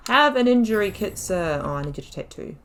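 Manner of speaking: normal talking voice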